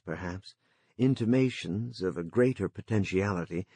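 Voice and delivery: usual speaking voice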